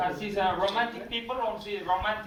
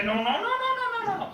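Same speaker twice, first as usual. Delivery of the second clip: fussy customers; 14 semitones higher, much faster than usual. Retold or imitated. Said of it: "And they".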